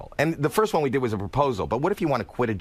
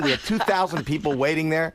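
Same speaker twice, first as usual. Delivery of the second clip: normal talking voice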